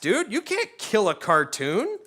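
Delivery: ordinary talk, nothing marked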